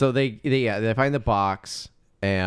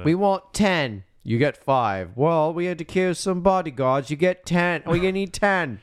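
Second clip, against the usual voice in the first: lightly voiced haggling thug, higher; 7 semitones higher than usual. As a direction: bargain like a greedy grave robber. Voicing the haggling grave robbers.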